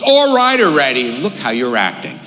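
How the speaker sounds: high, loud exasperated snap. Retold or imitated. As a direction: blow up at a partner, pitch leaping then easing. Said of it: "You start raising your voice".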